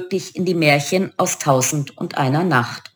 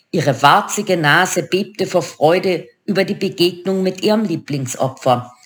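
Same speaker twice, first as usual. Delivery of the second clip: usual speaking voice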